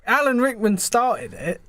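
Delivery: ordinary talk, nothing marked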